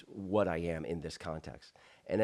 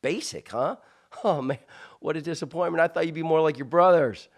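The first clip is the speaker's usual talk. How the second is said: put-on higher and louder, joking ribbing of the kid; mocking coach; 8 semitones higher, 9 dB louder than usual. Coach ribbing the youngest brother at practice, higher and louder mock. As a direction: imitate a coach teasing a boy, building to a loud jab. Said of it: "and he goes".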